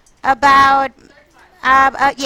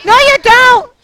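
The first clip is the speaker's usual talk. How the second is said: loud, high-pitched contradiction; real yelling; 10 semitones higher, 8 dB louder than usual. Real, not acted. Teacher contradicts her students, yelling. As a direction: yell it back at them. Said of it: "I did not mean to yell at you".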